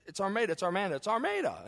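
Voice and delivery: insisting, voice climbing high; stubbornly insisting with rising pitch; mock arguing. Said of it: "I'm arguing with the mom".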